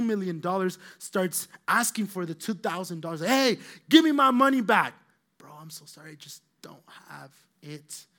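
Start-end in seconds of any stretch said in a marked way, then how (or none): 3.2-4.9 loud, high shout for money; the forgiven servant demands his money, shouting; angry demand. Acted. Forgiven servant demands a small debt. 5.4-8.0 much softer, small pleading voice; the poor debtor begs softly for mercy; meek apology. Acted. The debtor begs for more time.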